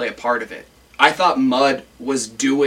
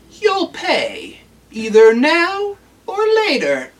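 put-on high, drawn-out old-man warning; mock old man; 14 semitones higher, slower than usual. Mudd warning the Captain he'll pay, mock old-man voice. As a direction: threaten in a hammed-up high, aged voice. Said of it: "He's not old".

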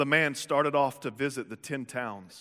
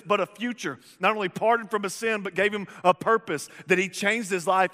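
rising, louder preacher climax, building fervour; preaching at full force; 4 semitones higher, 5 dB louder than usual. Acted. Pastor exhorting the congregation, building to a loud preach. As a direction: proclaim it to the crowd like a revival preacher.

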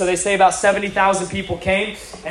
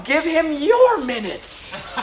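high, drawn-out mock indignant demand; slow, high-pitched retold scolding; Joy Dawson; 7 semitones higher, much slower than usual. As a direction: imitate an affronted elder, stretching each word. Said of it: "she is livid".

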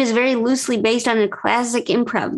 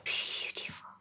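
breathy whisper; 19 dB quieter, slower than usual. Real, not acted. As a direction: breathe the word out in a hush. Admires the mind-meld moment.

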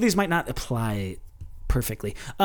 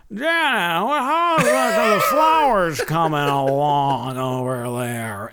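lightly voiced old man, drawn out; a hint of a gruff old neighbor; old actor voice; slower than usual. Old actor calling down the block, lightly voiced old man. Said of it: "I heard this voice".

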